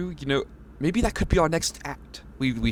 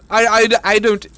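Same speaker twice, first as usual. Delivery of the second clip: flustered outburst; 8 semitones higher, 7 dB louder than usual. Acted. Flustered at the clown.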